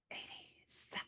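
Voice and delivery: breathy spooky whisper. Pretend. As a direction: whisper it like a secret. Echoing the decade dramatically.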